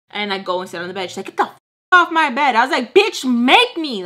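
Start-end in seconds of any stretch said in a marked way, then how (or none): 1.3-2.5 loud, high-pitched angry order; retold yelling. Roommate ordering her off the bed, yelling. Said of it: "yelling at each other". 2.9-4.0 loud, high, drawn-out defiance; mad retort. Retold or imitated. Snapping back at the roommate's order, mad and defiant. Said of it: "I was so mad".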